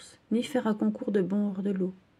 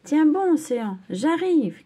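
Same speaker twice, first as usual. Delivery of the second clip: high, louder call after a friend; urgent shout; 8 semitones higher, 6 dB louder than usual. Acted. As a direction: shout across the water, urgent. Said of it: "crie Océane". (cri pressant, aigu et fort)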